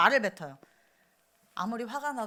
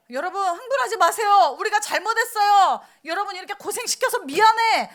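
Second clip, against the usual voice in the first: higher, louder placating plea; mock pleading; 8 semitones higher, 9 dB louder than usual. Moses placating the angry crowd, pleading. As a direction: beg the crowd to calm down. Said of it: "무릎을 꿇은 게 아니에요".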